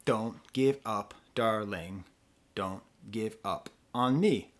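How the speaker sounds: slow, syllable by syllable, "darling" split into two beats; iambic scansion. Shown as a demonstration. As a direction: test the meter on "Don't give up" in even, spaced beats. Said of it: "is this song in iambic pentameter?".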